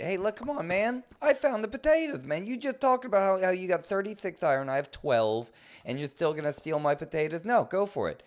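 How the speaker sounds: high, loud, aggrieved complaining; shrill-pitched mock outrage over potatoes; indignant outburst. Real, not acted. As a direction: protest like your find was stolen. Indignant rant over stolen potatoes.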